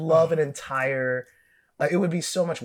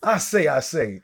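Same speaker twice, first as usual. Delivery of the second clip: Foghorn Leghorn; 3 semitones higher, 3 dB louder than usual. Pretend. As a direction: boom it like a cartoon rooster. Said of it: "clan name Foghorn".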